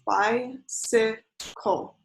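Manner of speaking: "Bicycle" drawn out slowly, each syllable separated: bi-cy-cle; slow syllable count. Shown as a demonstration. Teacher to viewers counting on fingers, "Bicycle" slow and split into syllables. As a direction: stretch "Bicycle" so each beat can be counted. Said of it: "you can count on your fingers".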